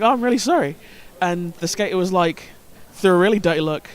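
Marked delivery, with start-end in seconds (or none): 0.0-0.8 retold apology.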